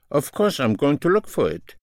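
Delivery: ordinary talk, nothing marked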